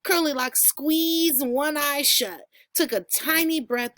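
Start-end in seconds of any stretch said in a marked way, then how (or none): none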